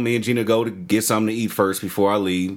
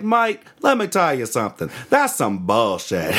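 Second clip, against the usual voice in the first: put-on Australian accent; 3 semitones higher than usual. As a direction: play an Aussie setting someone straight. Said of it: "One of those dudes from Australia".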